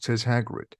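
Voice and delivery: usual speaking voice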